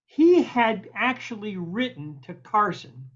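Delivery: ordinary delivery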